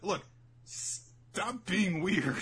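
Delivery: high, very slow deadpan; hammed-up weary put-down; slow annoyed retort. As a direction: drag it out, fed up. Sai retorting.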